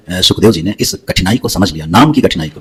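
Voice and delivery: ordinary delivery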